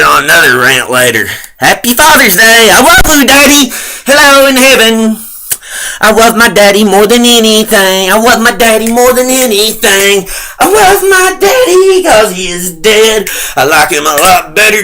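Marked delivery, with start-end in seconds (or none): 1.6-5.2 put-on high, loud sugary little-kid voice; mock sweet child. Son mockingly greeting his dead daddy, sugary child voice. 6.0-13.3 put-on high sugary little-kid voice, sarcastic; mock childish. Son mockingly declaring love for dead dad, sugary child voice.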